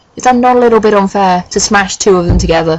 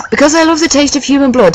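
high, lightly giggly voice; 7 semitones higher than usual. A narrator's reading. Boy confessing his taste for blood, high giggly voice. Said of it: "he giggled".

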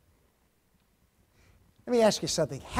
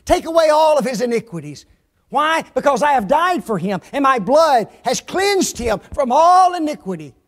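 loud, high plea of an advocate; Jesus; 6 semitones higher, 8 dB louder than usual. Acted. Advocate pleading to God for a sinner, loud impassioned preaching. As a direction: plead the case forcefully before the judge. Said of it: "he says".

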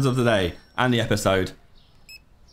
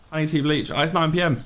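ordinary delivery

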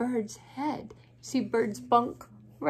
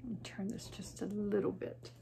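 whisper; 12 dB quieter, faster than usual. Real, not acted.

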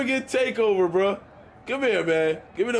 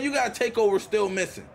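ordinary talk

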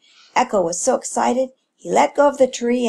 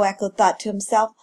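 ordinary delivery

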